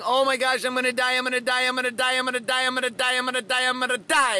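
high monotone chant of panic; mock panicked yelling. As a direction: rattle it off in hammed-up hysteria. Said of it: "stop yelling at yourself and saying".

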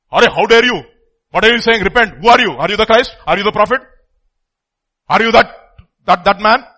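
loud indignant questions fired off; angry interrogation. Acted. Crowd confronting John the Baptist, loud angry questioning. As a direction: demand answers, scornful and loud.

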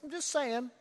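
high, light, noncommittal shrug of a line; backing off. Retold or imitated. Nicodemus to the Pharisees, a lightly voiced sheepish backing off. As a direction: retreat without committing. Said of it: "Kind of backs off".

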